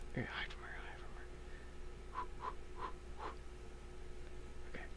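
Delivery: hushed whisper while hiding. Real, not acted. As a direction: whisper so she won't hear. Hiding from the seeker.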